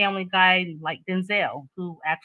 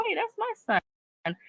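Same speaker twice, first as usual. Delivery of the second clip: high-pitched, softer double take; shocked realization; 14 semitones higher, 5 dB quieter than usual. Acted. Mother spotting her son on social media, shocked high voice. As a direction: blurt it in a high, disbelieving voice.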